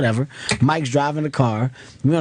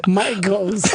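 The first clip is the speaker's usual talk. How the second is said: ordinary talk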